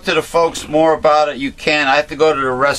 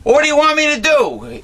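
exasperated; 11 semitones higher than usual. Real, not acted. Exasperated retort in argument.

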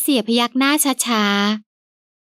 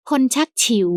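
usual speaking voice

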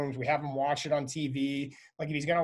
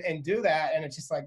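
ordinary talk

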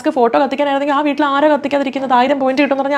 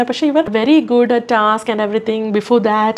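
slow drawn-out English; much slower than usual. Retold or imitated. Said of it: "എന്നൊക്കെ പറഞ്ഞിട്ട്".

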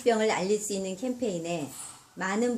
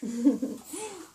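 bright laugh after the ice water; burst of laughter; 4 semitones higher than usual. Real, not acted. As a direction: laugh out in shock at the cold. (얼음물 맞고 터진 웃음)

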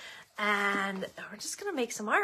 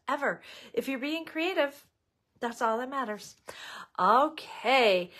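normal talking voice

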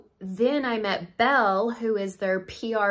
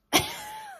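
a squeaky little giggle; high giggle. Real, not acted. To viewers about a secret product, a squeaky giggle. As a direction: giggle high over a secret you can't share.

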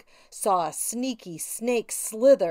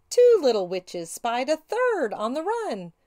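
plain reading voice

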